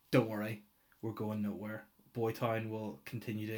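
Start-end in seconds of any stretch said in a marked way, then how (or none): none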